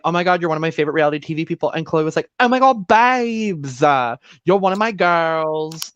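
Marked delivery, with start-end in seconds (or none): 0.0-1.7 rapid excited fangirl gush; fast gushing. Retold or imitated. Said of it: "Kat was like". 2.4-5.9 put-on high gushing Essex accent. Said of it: "the accent today".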